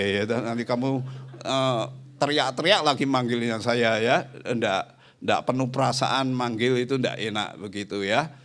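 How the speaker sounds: normal talking voice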